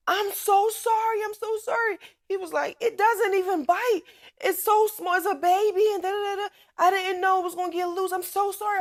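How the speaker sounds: put-on high young boy, pleading and sorry; dog owner boy apologizing to mom; apologetic boy. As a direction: plead like a kid caught out. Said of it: "He said".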